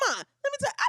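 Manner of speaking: very high mock tattling; hammed-up call to mama; calling mom. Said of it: "I'll be like".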